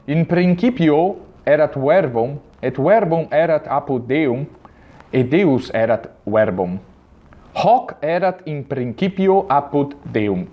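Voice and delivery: Latin read with hard k in "principio" and w in "verbum"; slow reconstructed Latin, every consonant hard; classical Latin. Shown as a demonstration. Teacher reads the same verse in classical Latin. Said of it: "E na pronúncia reconstituída".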